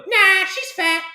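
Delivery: very high, squeaky creature voice; little alien. Retold or imitated. Alien butting in on the Tinder date, high squeaky voice. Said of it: "And the little alien's like".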